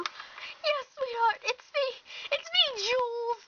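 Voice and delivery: high, weepy, melodramatic mother; tearful reunion. Acted. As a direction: coo it high through tears.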